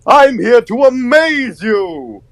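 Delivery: high, slow, far-from-natural cartoon showman; big high-pitched showy announcement; character audition voice. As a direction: draw it out high like a cartoon entertainer. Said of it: "I just did a really energetic Buzu".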